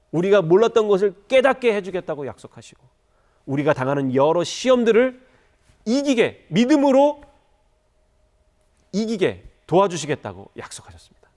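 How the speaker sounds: impassioned preacher at full voice; forceful preaching. Real, not acted.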